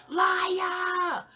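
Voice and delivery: high shouted "Liar"; high, sharp mock yell; shouted accusation. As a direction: fling the word at her. Aunt yelling accusation.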